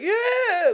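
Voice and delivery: very high, loud panicked shriek; imitated shrill scream. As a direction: let out a piercing mock scream. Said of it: "like, this shrill of a scream".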